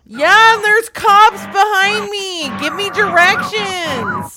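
frantic yelling. Acted. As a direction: scream for help while fleeing. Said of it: "so it sounds like you're frantic".

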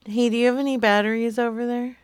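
normal talking voice

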